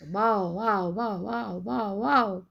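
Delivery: rhythmic run of bow-wows; singsong teacher's bow-wow; dog bark imitation. Shown as a demonstration. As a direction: imitate a barking dog, lightly. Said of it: "So dog will bark".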